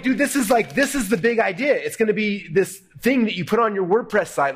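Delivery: high, eager retold pitch; excited. Partners dreaming up a WordPress product, excited. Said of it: "we're freaking out excited".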